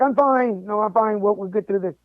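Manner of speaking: ordinary talk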